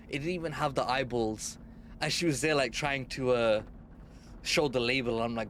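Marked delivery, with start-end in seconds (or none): none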